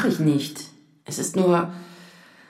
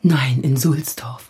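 whisper; 11 semitones lower, much slower than usual. Acted. Hushed talk before playing recording.

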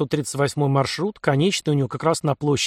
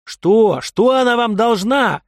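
loud, higher furious yelling; furious shout; 8 semitones higher, 6 dB louder, slower than usual. A narrator's reading. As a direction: spit the words out in rage. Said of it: "брызжа слюной и яростью".

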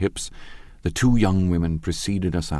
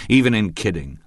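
cross; 4 semitones higher, 5 dB louder than usual. Acted. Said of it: "objected Tom crossly".

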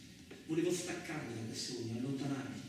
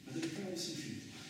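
lost mutter; 3 semitones higher, 7 dB quieter than usual. Acted.

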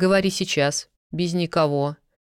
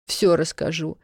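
flustered rambling; 4 semitones higher than usual. Acted. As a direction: tumble out a scared, confused account. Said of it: "сбиваясь".

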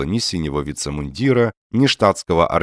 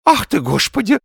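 high, loud fluttering exclamation; agitated old man's high lisp; flustered old man; 5 dB louder than usual. A narrator's reading. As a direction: get all agitated, voice shooting up. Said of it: "заволновался старик".

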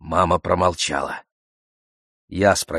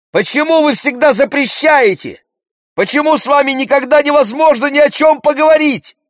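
high, loud, furious protest; yelling; 8 dB louder than usual. A narrator's reading. Brother rages at parents' bans, yelling. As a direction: keep yelling at full pitch, exasperated. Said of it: "закричал Андрей".

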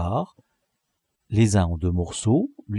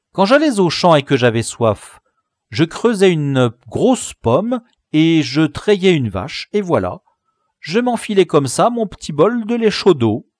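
higher, louder boastful story; triumphant bragging; 6 semitones higher, 5 dB louder than usual. A narrator's reading. La crique explaining to the gang, triumphant. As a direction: show off your know-how. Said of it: "loquace et triomphant".

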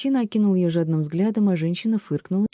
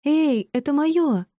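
high, drawn-out protest; indignant cry, pitched way up; indignant call; 11 semitones higher, 4 dB louder, much slower than usual. A narrator's reading. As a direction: call after a thief, slow and high. Heroine calls after thieving boy.

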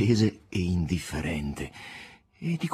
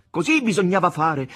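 loud proclamation; 10 semitones higher, 6 dB louder than usual. Acted. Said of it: "ripeté come un eco il seguito".

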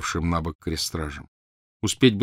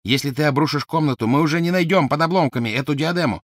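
higher urgent warning; shouting Malfoy; 8 semitones higher, 4 dB louder than usual. Acted. Malfoy warns Crabbe, higher and urgent. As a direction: stop him before it's too late. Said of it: "крикнул Малфой".

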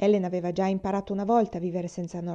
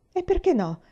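sharply higher irritated cry; irritated exclamation; 12 semitones higher, 5 dB louder than usual. Acted. Ellen to her sister, exclaiming a little irritated. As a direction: snap back, a little irritated. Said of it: "esclamò Ellen, un po' irritata".

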